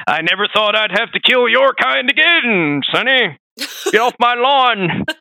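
put-on cranky old man yelling, slow and high; grumpy grandpa chasing kids off; old prospector. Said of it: "He's like, Old Man Ishin".

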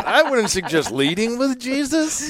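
high, mock-prim echo; mocking replay. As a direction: parrot her line back in a high voice. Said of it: "That is the clip we're using".

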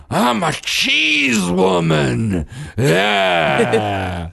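high, slow, loud hammed-up cheese woman; child playing a goofy cheese woman character; silly cheese woman. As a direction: belt it like a proud cartoon hero. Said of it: "playing this character where she goes".

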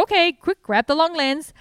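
urgent, thrilled shout to the team; excited call. Retold or imitated. Rescuer calling to staff to grab the lens, excited and loud.

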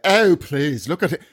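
mock-posh English tea drinker; English accent. Mimicking someone holding their tea, English accent. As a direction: put on a dainty English accent. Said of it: "in the English accent".

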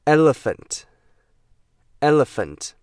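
"elephant" stretched slowly with a clear e sound; slow model word. Shown as a demonstration. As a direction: drill "elephant" slowly for students to repeat. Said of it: "pronunciation drill".

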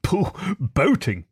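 pooh-poohing in high-pitched disgust; scornful, drawn-out dismissal from a pompous toad; great disgust. A narrator's reading. Toad interrupts talk of boating, in great disgust. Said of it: "interrupted the Toad in great disgust".

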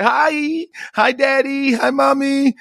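high-pitched imitation of a small child; high, drawn-out kid voice; little daughter's greeting. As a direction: sing out hellos like a little girl. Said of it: "She said hi to all of us".

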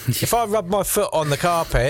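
put-on first rough stab at a Massachusetts accent; supposed Massachusetts accent. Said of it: "in a Massachusetts accent, probably".

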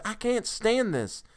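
high, slow, strained plea; desperate cry. A narrator's reading. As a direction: plead at the end of endurance. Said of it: "he cried hoarsely".

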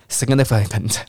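quick, slightly tumbling "significant"; sped up. Shown as a demonstration. Teacher demonstrates speeding up and stumbles, hurried "significant". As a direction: rush through "significant" so syllables run together. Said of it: "那加快".

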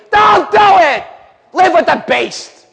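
high, loud exhortation; mock urging shout. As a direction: shout it like a tempter egging them on. Voices the culture's temptation.

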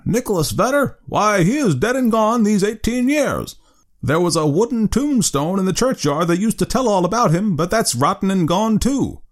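put-on old man telling Rip his friend is dead, thin and piping. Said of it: "replied in a thin, piping voice".

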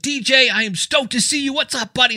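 high, hyped-up podcast host greeting; John Lee Dumas impression. Imitating John greeting his friend with hyped delight. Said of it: "you sound like John Lee Dumas when you do that".

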